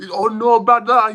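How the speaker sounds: high, put-on whiny voice; mocking the streamer. Streamer imitates a streamer reacting to alcohol, high mocking voice. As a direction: squeak it like a mocking kid. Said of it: "he said".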